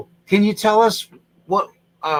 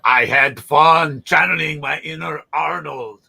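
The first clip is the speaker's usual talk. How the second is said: Arnold voice; 5 dB louder, slower than usual. Retold or imitated. Guest keeps the Arnold voice.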